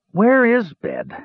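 slow, high, weary question; plaintive, worn-out asking; tired. A narrator's reading. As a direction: drag it out wearily. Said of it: "Palin asked tiredly".

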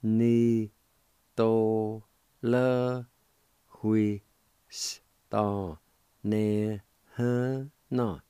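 each syllable given separately; slow broken-up demo. Shown as a demonstration. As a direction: spell it out in sound pieces. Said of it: "it's pronounced like this".

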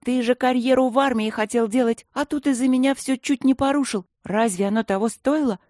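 put-on a young girl scolding her friend. A narrator's reading. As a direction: tell him off, worried and cross. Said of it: "ругала Алинка Матвея".

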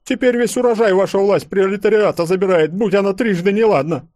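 high, loud indignant complaint; stout peasant woman. A narrator's reading. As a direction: rail against the requisitions. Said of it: "подхватила вдруг толстая баба". (толстая баба возмущённо кричит)

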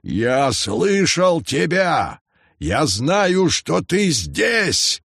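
calling out at full lungs; shouting. A narrator's reading. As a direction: shout it across the island. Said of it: "закричал во всю силу лёгких".

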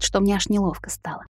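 ordinary delivery, nothing marked

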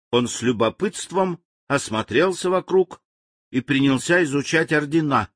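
usual speaking voice

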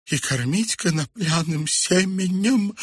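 high, plaintive murmur; thin high-pitched lament; faint muttering. A narrator's reading. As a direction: moan it to yourself. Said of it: "еле слышно пробормотала Хомели".

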